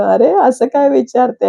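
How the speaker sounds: mock indignant. Sagar's retort, acted quote.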